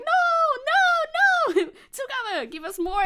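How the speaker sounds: high, loud, drawn-out mock protest; retold crowd pleading. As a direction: wail it like fans begging for an encore. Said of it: "And we were like".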